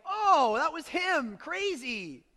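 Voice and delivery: drawn-out, high, loud mock astonishment; amazed realisation. Disciples realising who just vanished, amazed. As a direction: play up the stunned penny-drop moment.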